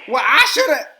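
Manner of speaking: high, loud sassy comeback; defiant retort. Retold or imitated. Wife defiantly talking back to her husband, loud sassy retort.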